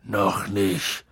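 low, downcast character voice; subdued, low-pitched gloom; dejected. A narrator's reading. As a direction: say it heavily and glumly. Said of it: "sagte Hagrid bedrückt".

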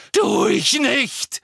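high, loud, slow scream of denial; furious teenage shout; Harry screaming. Acted. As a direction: hurl the denial at him. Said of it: "schrie Harry so laut".

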